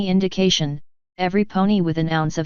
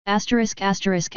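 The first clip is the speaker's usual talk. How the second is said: plain reading voice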